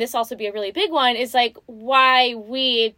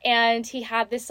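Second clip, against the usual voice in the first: ordinary delivery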